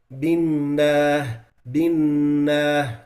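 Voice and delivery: binna with a nasal hum, very slow; added ghunna. Shown as a demonstration. Teacher showing the nasal mistake. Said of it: "like this".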